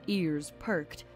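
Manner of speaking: ordinary delivery, nothing marked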